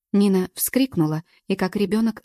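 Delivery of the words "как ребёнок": ordinary delivery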